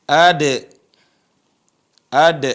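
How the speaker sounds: "ad" loud, with a bouncing d; qalqalah dal. Shown as a demonstration. Teacher repeats for students, "ad" with a bounced release. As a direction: pop the d of "ad" into a short echo. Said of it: "dia harus qalqalah".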